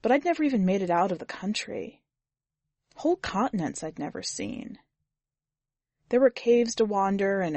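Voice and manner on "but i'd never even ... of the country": ordinary delivery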